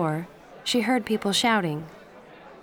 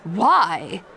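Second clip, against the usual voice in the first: shouted; 5 dB louder than usual. Acted. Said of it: "Aunt Grace shouted".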